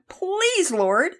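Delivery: high, loud, drawn-out plea; pleading prayer. A narrator's reading. Young pitcher prays mid-game, high desperate plea. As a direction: beg for help with the pitch.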